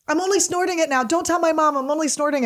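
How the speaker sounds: high, pleading imitation of the friend; mock pleading friend. Friend begging narrator to keep her secret, high mock plea. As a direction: plead like a junkie caught out.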